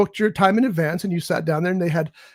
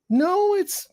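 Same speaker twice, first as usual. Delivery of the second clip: protesting; 12 semitones higher, slower than usual. Retold or imitated. Retelling arguing with interviewer.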